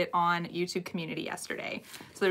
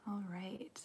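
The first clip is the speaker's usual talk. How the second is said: whispering; 15 dB quieter than usual. Real, not acted. Still alone on the live.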